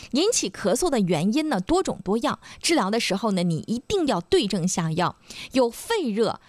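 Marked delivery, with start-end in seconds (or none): none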